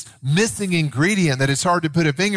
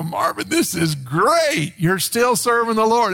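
retold delighted greeting; 9 semitones higher than usual. Retells happy reunion greeting.

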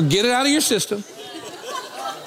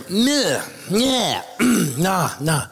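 tongue-tied babble; much slower than usual. Retold or imitated. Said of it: "He walks over here".

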